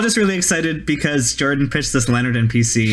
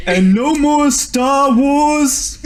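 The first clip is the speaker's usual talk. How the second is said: slow, loud joke shout; mock rally shout; 10 semitones higher, 4 dB louder, much slower than usual. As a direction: bellow it like a campaign promise. Joke campaign promise, shouted.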